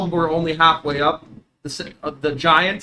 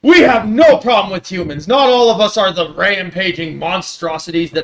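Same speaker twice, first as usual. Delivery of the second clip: loud offended giant voice; dopey cartoon bellow; 4 semitones higher, 8 dB louder than usual. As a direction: boom it out like an indignant giant.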